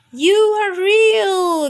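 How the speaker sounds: high, loud, slow gasp of wonder. A narrator's reading. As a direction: breathe it out in amazed delight. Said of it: "gasped the polar bear".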